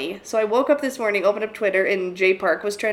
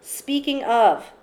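drawn out and higher; slow drawn-out; 5 semitones higher, 3 dB louder, much slower than usual. Acted. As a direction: stretch the segue out slowly. Repeated, drawn out as segue.

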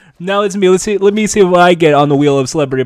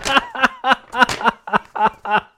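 Jimmy Fallon; 2 semitones higher than usual. Acted. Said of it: "Jimmy Fallon impression".

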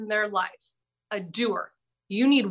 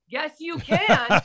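"can" drawn out on a higher pitch; emphatic rebuttal; 5 semitones higher, slower than usual. Real, not acted. Storyteller rebutting her friend's claim, emphatic. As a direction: contradict firmly, stretching "Yes, you can".

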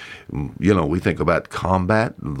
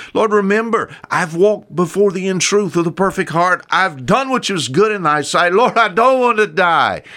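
higher, pleading cry to God; pleading prayer; 9 semitones higher than usual. Retold or imitated. Hezekiah praying toward the wall, begging not to die, pleading. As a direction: beg for your life, voice lifting. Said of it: "he begins to plead his righteousness".

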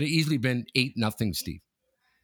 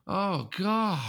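dismayed senior; 9 semitones higher than usual. Retold or imitated. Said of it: "they go like this".

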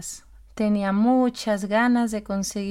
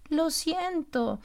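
higher, slow and softer sigh; sighed apology; 7 semitones higher, 4 dB quieter, much slower than usual. Acted. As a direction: breathe the sorry out gently. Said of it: "suspiró la señora pingüino".

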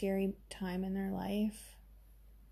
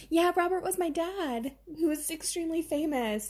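high, louder, gushing mock celebrity; 8 semitones higher, 3 dB louder than usual. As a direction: ham up a starstruck celebrity bragging about her dad. Celebrity answers psychic, mocking.

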